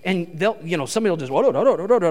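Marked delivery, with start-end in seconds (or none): 1.3-2.1 imitated garbled caller babble; messed-up phone voice. Caller on the horrible church phone, garbled. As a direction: babble nonsense syllables like a bad phone line. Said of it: "somebody's voice really messed up".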